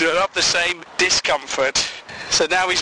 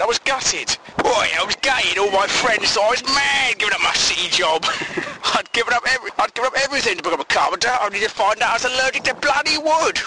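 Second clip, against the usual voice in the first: higher, broad put-on cockney voice; cockney accent; 7 semitones higher, faster than usual. Retelling the ex-banker's story in a cockney accent. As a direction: play the ex-banker as a chirpy cockney. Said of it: "I'm just pretending he's a cockney".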